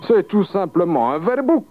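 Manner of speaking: high-pitched, sly answer; mischievous. A narrator's reading. Peasant naming the beast to the devil, mischievously. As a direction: toss off the answer with mock-innocent mischief. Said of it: "s'exclama malicieusement".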